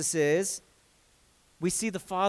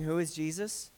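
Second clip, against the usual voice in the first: soft quoted question; hushed question; 3 semitones lower, 10 dB quieter than usual. Retold or imitated. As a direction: drop to a hushed wondering voice. Disciples' question quoted softly.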